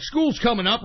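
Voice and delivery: ordinary delivery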